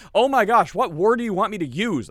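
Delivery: high, loud, exasperated outburst; mock exasperation. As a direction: act fed up with being corrected. Retells exasperation over word choice.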